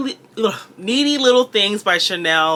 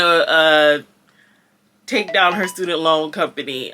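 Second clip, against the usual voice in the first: ordinary talk, nothing marked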